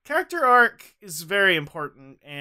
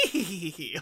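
mocking laughter; 9 dB quieter than usual. Friend imagining John Smith taunting the spanked cohost, mock giggling. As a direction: mimic someone dancing around laughing at you. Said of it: "like, laughing at you".

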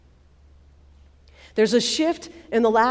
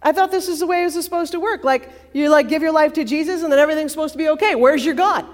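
fast, louder exasperated rant; frustrated rant; 5 semitones higher, 6 dB louder, much faster than usual. Retold or imitated. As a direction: pour it out, fed up and fast. Voicing a frustrated believer.

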